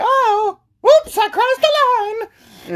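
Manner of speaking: very high falsetto whine; mock whoops voice. Friend crosses the forbidden line, mock falsetto dismay. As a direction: squeal a mock oops.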